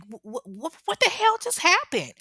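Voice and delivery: stutter, then a loud high shriek; shocked disbelief bursting out; stammering shock. Retold or imitated. The cousin seeing Daphne shot, stuttering into a shriek. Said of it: "she's like".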